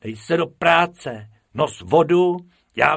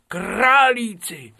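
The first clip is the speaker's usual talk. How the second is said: much higher, louder and slow: králíci drawn out in delight; delighted cry; 12 semitones higher, 7 dB louder, much slower than usual. A narrator's reading. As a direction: call out králíci like spotting friends. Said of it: "králíci repeated". (nadšeně protažené králíci)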